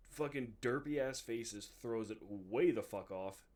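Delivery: usual speaking voice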